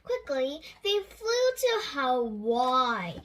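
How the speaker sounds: plain reading voice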